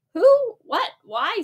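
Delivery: a high, loud startled "Who?" then flustered; stammering. Acted. Judy stammers in shock at William's fancy dishes. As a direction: caught off guard, tripping over the questions. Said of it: "stammered Judy".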